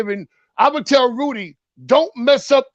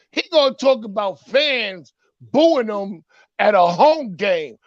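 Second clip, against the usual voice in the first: normal talking voice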